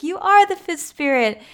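high-pitched, lightly acted wonder; mock amazed realization. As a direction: imitate a dawning realization, pitch jumping up then falling. Voicing Anna's amazed realization.

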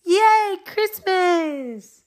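high, squealing fake delight; mock cheer. Parodying people who cheer for Christmas at midnight, high mock squeal. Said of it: "people are like".

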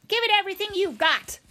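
high, loud, fast rallying shriek. A narrator's reading. Owl spurring the swarm of birds on, shrieking. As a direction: shriek it out to spur the flock. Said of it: "shrieked the owl".

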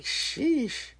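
high breathy amazed sheesh; drawn out high in astonishment. Retold or imitated. Seeing eight kids hop out, high amazed sheesh.

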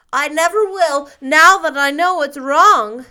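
put-on high, loud tearful little boy; earnest, between sobs. A narrator's reading. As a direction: swear it like a crying child. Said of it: "asseverated Davy between sobs".

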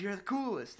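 loud, high nerdy enthusiasm; mock gushing. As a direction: gush in over-the-top admiration. Acting out Eugene's gushing.

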